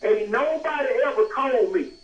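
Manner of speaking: ordinary talk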